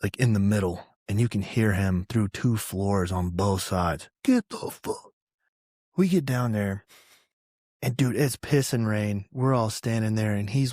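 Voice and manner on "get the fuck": mock shouted order, higher; retold yell. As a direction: bark it down the hall half-voiced. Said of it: "he yells from".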